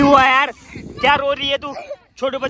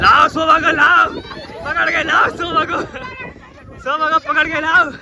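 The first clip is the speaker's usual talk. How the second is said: calling out; 8 semitones higher than usual. Real, not acted. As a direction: shout across for Shobha to be brought. Calling to fetch Shobha.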